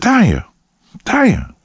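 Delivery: high-pitched call to rouse someone; calling her name. Retold or imitated. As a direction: call out high, checking for a response. Calling to unresponsive passenger.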